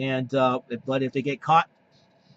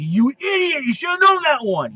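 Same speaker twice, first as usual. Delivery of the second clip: loud, higher mock yell at a contestant; 7 semitones higher, 6 dB louder than usual. Fan at home yelling at a game show contestant, loud mock yell. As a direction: bark it at the screen like a frustrated viewer. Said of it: "will probably scream at the T V".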